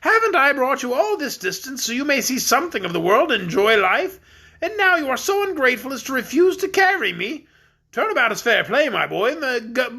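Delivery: high, jolly voice of a stout king; fat jolly king. Acted.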